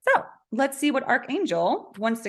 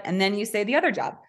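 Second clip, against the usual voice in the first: usual speaking voice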